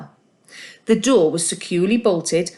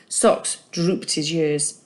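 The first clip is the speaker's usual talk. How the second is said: ordinary delivery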